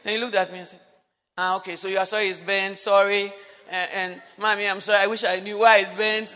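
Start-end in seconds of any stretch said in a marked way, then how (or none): 1.4-6.3 fast, loud, careless sorry; rushed apology. Retold or imitated. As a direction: rattle off the apology to get it over with. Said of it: "That fa-, fast like that".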